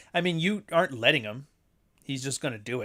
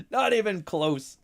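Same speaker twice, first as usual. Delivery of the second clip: high, slow, through a laugh; amused, laughter-pitched dismissal; 9 semitones higher, slower than usual. Real, not acted. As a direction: say it while still laughing, drawn out. Laughing at own joke.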